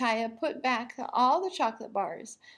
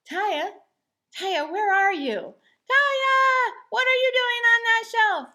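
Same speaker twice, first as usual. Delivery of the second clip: rising, louder calls; calling out; 11 semitones higher, 8 dB louder than usual. A narrator's reading. Father searching the store for his daughter, calling louder. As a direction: call for her across the store.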